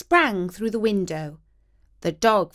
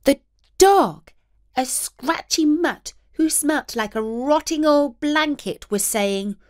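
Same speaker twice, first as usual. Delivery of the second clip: plain reading voice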